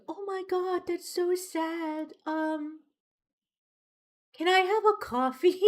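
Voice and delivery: high, put-on sympathetic voice; awkward mock sympathy. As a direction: feign pity, then timidly ask a favor. Acts out own awkward reply.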